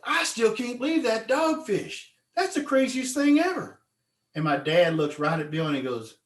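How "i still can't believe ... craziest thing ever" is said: amazed friend. Retold or imitated. Bill still amazed at the ramp.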